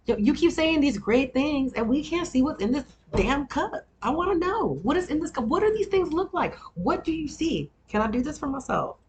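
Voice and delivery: high-pitched comic rant; worked-up, insistent questions in a high voice; exasperated demand. Real, not acted. Comic rant demanding to see cup.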